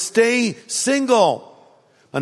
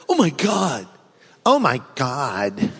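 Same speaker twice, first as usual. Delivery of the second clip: lightly voiced gushing omg, drawn out; a breathy-free mock gasp of oh my God with a laugh; casual oh my God said out of habit; 6 semitones lower than usual. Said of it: "a default expression".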